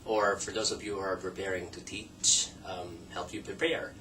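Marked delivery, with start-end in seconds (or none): none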